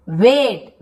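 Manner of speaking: "वेट" with a long, drawn-out ए; long vowel. Shown as a demonstration. Teacher giving the first example, long-voweled "वेट". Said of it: "ऐसे मतलब वेट".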